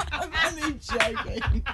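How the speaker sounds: laughing while apologizing; high, giggly apology. Real, not acted. Teasing friend, laughing apology.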